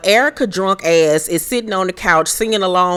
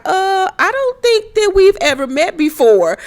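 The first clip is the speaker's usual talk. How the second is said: pitched way up, prissy and puzzled; high mocking Cherie voice; 13 semitones higher than usual. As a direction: squeak it out like a confused lady. Cherie quoted, much higher.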